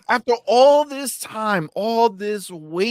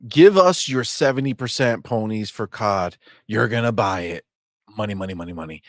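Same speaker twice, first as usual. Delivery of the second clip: reading super chat; 10 semitones lower than usual. Retold or imitated. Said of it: "It says".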